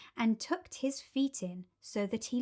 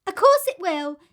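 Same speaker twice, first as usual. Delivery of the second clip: put-on confident children; 9 semitones higher, 12 dB louder than usual. A narrator's reading. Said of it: "the others said".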